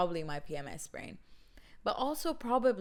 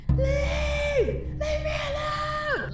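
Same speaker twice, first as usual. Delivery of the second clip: very high, hammed-up shriek; dramatic scream; 8 dB louder, much slower than usual. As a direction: scream hysterically to drive him away. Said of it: "I'm so dramatic".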